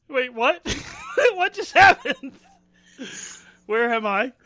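high, loud, laughing disbelief; cracking up while asking what happened; laughing confusion. Real, not acted. Confused after being teased.

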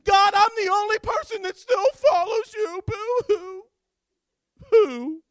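high, loud boo-hooing whine; mock complaining. Elijah complaining to God in the cave, mock whining. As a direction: ham up a self-pitying wail to God. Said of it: "He actually begins to complain to God".

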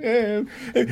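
high imitated panicky grunts; high mock panic noises while biting his nails; high nervous mock noises. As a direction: squeak like a nervous friend.

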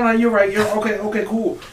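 normal talking voice

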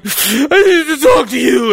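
mock crying wake-up line; imitating tearful girlfriend. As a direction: girlfriend in tears at night, slow tearful plea. Courtney waking him, voiced.

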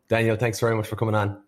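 ordinary talk, nothing marked

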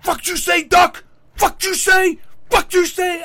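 high, loud mock tough-guy shout; tough-guy yelling. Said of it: "he starts yelling at the geese".

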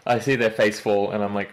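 ordinary talk, nothing marked

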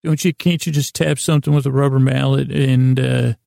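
usual speaking voice

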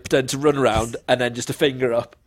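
usual speaking voice

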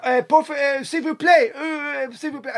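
high, hesitant broken French; halting phrasebook tourist. Retold or imitated. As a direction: stumble through the phrasebook French. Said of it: "I was there".

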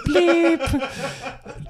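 high laughing "bleep". Real, not acted. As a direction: squeal it through laughter. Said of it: "I didn't even mean to say that".

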